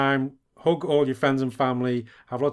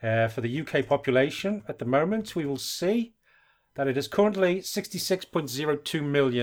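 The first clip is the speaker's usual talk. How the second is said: lightly put-on posh English voice; a hint of upper-class British; mock posh British accent. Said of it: "I lost my, my posh accent".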